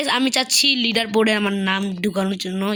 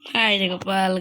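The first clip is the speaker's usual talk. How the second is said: normal talking voice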